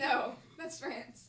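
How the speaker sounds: slow, laughing correction; amused, drawn-out correction. Real, not acted. Correcting friend while laughing.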